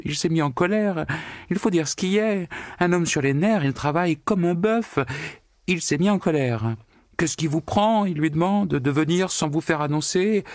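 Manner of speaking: high, breathless retelling; distraught telling. A narrator's reading. Secretary retelling the cat-headed visitor to the accountant, high and upset. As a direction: rattle it off, beside herself. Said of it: "racontait toute bouleversée".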